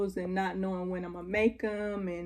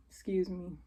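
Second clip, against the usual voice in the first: whispered; 5 dB quieter than usual. Real, not acted. Soft aside after a sigh.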